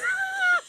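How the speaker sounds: very high shriek of laughter. Real, not acted. Shocked laugh at crude joke.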